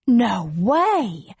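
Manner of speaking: incredulous gasp of words; disbelief. Acted. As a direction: react in astonished disbelief. Dixie reacts to the news.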